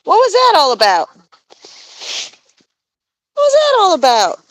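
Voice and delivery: high coaxing voice to a bird; pet-talk voice. Real, not acted. Asking the goose Layla what's wrong, high coaxing.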